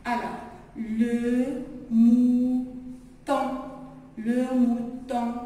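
"le mouton" lentement, en appuyant; étire "le mouton" pour les élèves; lecture lente. Shown as a demonstration. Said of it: "M, ou, mou". (lecture modèle lente)

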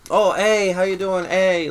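high, loud cartoon Canadian accent; mock the Canadians with an exaggerated accent. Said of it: "they all have that stupid Canadian accent".

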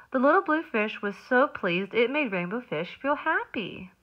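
lively storybook narration; reading to children. A narrator's reading. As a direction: read it like circle time. Reads picture book aloud.

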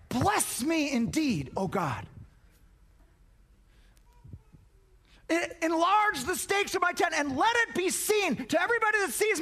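high, impassioned plea to God; preacher's ringing prayer; fervent prayer. Acted. Acts out Jabez's fervent prayer.

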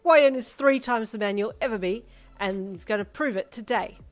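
defiant retort. A narrator's reading. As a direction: shout back in his defence.

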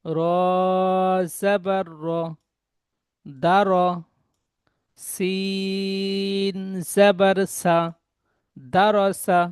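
slow, separated spelling then the whole word; teacher's deliberate spelling drill; spelled piece by piece. Shown as a demonstration. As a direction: cut each syllable apart before joining. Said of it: "ঠিক এমন কেটে কেটে বানান করবেন".

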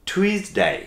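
"টুইসডে" with a puff of air on the T, pitched high; aspirated T. Shown as a demonstration. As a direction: start "টুইসডে" almost like ঠ. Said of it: "অনেকটা ঠয়ের মতো হবে". ("টুইসডে"-র ট অনেকটা ঠ-এর মতো)

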